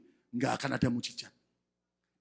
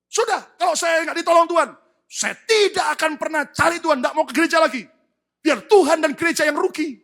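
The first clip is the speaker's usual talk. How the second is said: high, loud huffy threat; loud, high mock ultimatum; arrogant churchgoer; 10 semitones higher, 8 dB louder than usual. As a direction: sneer it like a spoiled churchgoer. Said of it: "Ada orang tuh sampai bilang gini".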